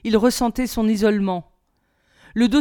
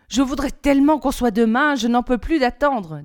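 put-on higher, louder, eager little-girl chatter; cheerfully; 5 semitones higher, 4 dB louder than usual. A narrator's reading. Lily tells her family at dinner she can't wait, cheerful and eager. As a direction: bubble over, unable to wait. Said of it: "dit-elle gaiement".

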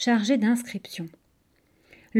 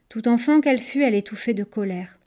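usual speaking voice

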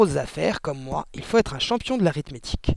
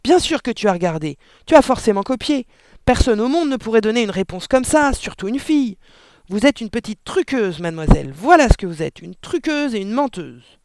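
loud, raised-pitch tirade; angry shouting; 6 semitones higher, 6 dB louder than usual. A narrator's reading. Father calling his daughter a cheat, shouting. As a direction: rant loudly at the child, hammering the accusations. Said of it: "cria le père".

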